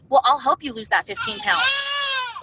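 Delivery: excited pitch. Retold or imitated. Saleswoman promising a customer weight loss, excited near-shout. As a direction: sell it with a big cheerful burst.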